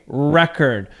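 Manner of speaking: record as REK-erd, stress at the start; record, first syllable strong; noun stress on first syllable. Shown as a demonstration. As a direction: lean on REK, let -erd fall away in record. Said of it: "The emphasis is at the beginning of the word".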